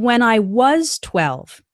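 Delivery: "was" stretched and stressed, each word slow. Shown as a demonstration. Teacher contrasting when I was twelve, stress on "was". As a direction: lean on "was" as you set it apart. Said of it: "there's a difference between".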